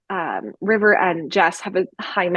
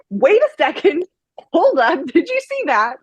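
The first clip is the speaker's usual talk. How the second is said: excited surprise; 9 semitones higher than usual. Retold or imitated. Reader reliving her shock at the slipped-in reveal, high and excited. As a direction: catch yourself spotting the hidden detail. Retelling own reaction to reveal.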